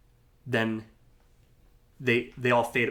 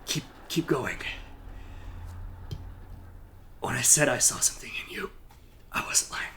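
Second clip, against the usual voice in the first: faint breathy last words, softer; softer dying mentor whispering goodbye; 6 dB quieter than usual. Acted. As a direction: whisper a final blessing as life slips away.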